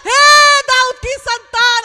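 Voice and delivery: shouting. Acted. Blind beggar shouting to the Son of David, full shout. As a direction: scream it out for mercy. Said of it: "आपको पता है मैं चिल्ला रही हूँ".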